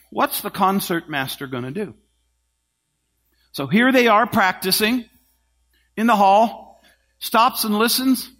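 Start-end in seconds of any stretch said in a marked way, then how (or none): none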